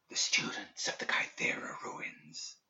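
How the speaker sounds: slow, breathless whisper from far off; ghostly whisper. A narrator's reading. Dead student's voice reaching Perdita's mind, ghostly whisper. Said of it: "Its whispering grew faint".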